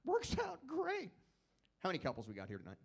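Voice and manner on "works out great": high, slow sing-song; high drawn-out. Acted. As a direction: stretch it out high and smug.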